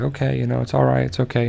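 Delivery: soft, hushed comfort; calm a scared child down gently; soothing mother. Retold or imitated. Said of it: "she's there being a mother for her".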